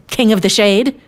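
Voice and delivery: acted huffy outrage; fuming. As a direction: rage like an offended story character. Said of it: "I'm fuming".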